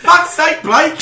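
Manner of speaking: loud, high exasperated cry; exasperated laughing yell at Blake; exasperated shout. Real, not acted. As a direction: cry out in mock despair. Exasperated at being interrupted.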